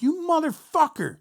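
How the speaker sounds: a stunned cry of betrayal; high incredulous curse. Retold or imitated. Band sees the roadie back.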